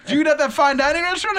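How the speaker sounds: put-on high, loud, fast old man's voice; lightly voiced, eager old man; anesthesiologist. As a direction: anesthesiologist asking eagerly about the restaurant.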